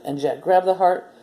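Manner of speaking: usual speaking voice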